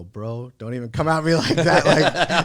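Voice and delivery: a pretend street threat to a coworker, laughing and loud; mock tough-guy threat. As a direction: puff up and half-laugh a warning. Mock-threatening the born-again question.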